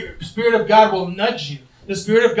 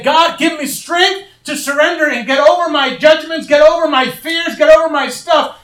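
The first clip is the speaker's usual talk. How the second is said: loud, high pleading prayer; fervent shouted prayer; 9 semitones higher, 6 dB louder than usual. Retold or imitated. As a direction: cry out to God for strength. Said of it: "every day have to say".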